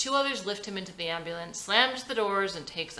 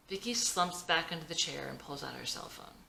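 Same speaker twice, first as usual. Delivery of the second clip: usual speaking voice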